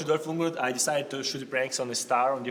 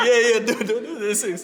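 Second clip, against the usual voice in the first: nervous humoring; 8 semitones higher, 4 dB louder than usual. Retold or imitated. Imitating Nicholson nervous.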